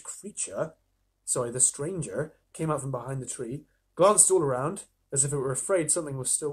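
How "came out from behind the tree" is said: plain reading voice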